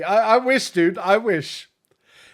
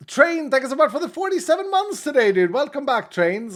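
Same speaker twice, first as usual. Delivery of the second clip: much higher, fast, cheerful thanks; excited greeting; 9 semitones higher than usual. Real, not acted. Streamer thanking a subscriber for forty-seven months, high and bright. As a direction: beam out a thank you.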